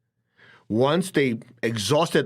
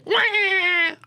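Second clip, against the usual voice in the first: high mock toddler wail; high-pitched pretend crying wah; fake baby wail. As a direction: wail like a toddler who saw mom. Child crying for mom.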